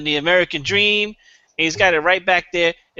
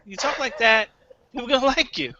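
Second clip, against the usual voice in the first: lightly pitched-up repeat, trailing into a laugh; put-on inflection; 6 semitones higher, 4 dB quieter than usual. Shown as a demonstration. Comic repeating his star-voice demo with a laugh. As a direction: say it again in the voice, laughing through it. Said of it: "you just have to put the inflection".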